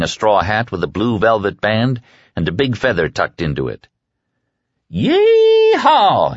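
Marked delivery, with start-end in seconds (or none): 4.9-6.3 high, loud whoop from a truck; joyful shout. A narrator's reading. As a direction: whoop it out with glee. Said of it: "he shouted and waved".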